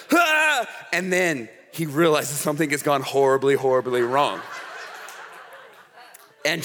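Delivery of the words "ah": excited yelp of triumph. Retold or imitated. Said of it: "He's like".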